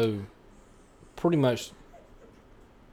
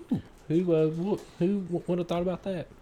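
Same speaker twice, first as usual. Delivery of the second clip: mock wonderment; 6 semitones higher than usual. Mock wondering movie-viewer reaction.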